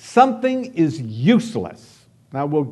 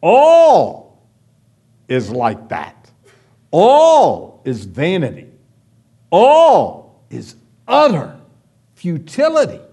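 dramatic declaration; 6 dB louder than usual. Retold or imitated. Pastor voicing the preacher's verdict on everything, thundering. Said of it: "Then he says".

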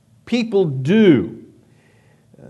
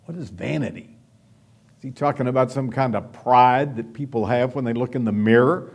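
ordinary talk

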